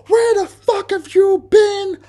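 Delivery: mock her screaming fury at full pitch; imitating raging girlfriend. Mimicking his girlfriend screaming at him for coming home late. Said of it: "She is raving fucking mad".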